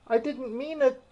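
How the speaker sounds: put-on a girl pleading with an offended mouse. A narrator's reading. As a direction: beg forgiveness anxiously. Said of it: "pleaded poor Alice".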